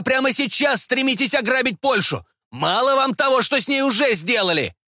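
indignant tirade. A narrator's reading. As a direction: accuse him with mounting outrage. Bound patriot rants at official.

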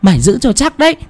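high sharp command; medium's sharp order. Acted. Medium barks at the young man holding the boy, high. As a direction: snap at the young man to hold tight. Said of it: "Cô Sàn nhìn gã trai kia mà quát".

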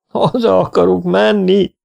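high, comic wail; howling. A narrator's reading. Struggling swimmer begs to go home, howling. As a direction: howl like a desperate child. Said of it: "üvöltötte".

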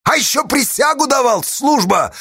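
high, heated bark; angry bark. A narrator's reading. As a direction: fling the reproach out in anger. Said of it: "рявкнул в сердцах Асташа".

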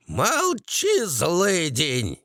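high-pitched hag's bark; old hag barking. A narrator's reading. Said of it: "гаркнула карга". (карга гаркает высоким голосом)

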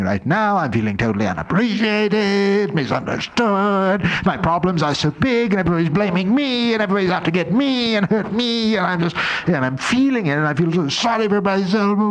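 high, whining voice full of self-pity; whiny self-pity. Acted. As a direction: whine like a wounded martyr feeling sorry for herself. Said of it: "It's recognizing".

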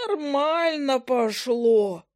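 high, slow drawn-out squeak; squeaky voice. Acted. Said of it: "неожиданно писклявым голосом протянул".

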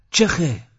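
sharp high shout at the dog. Acted. Abbas yells at the dog that stopped, a high shout. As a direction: yell to chase the dog off. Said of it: "عباس داد زد".

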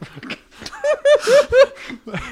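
high, loud burst of laughter; long high-pitched giggling fit. Real, not acted. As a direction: crack up helplessly. Cracking up at own joke.

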